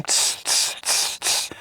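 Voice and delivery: soft unvoiced steam-valve hiss. Shown as a demonstration. Imitating a steam train letting off pressure. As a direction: let off steam like a train, tss tss. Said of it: "be the steam train".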